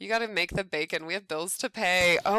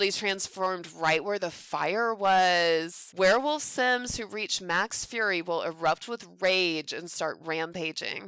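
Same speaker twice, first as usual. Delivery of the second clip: ordinary talk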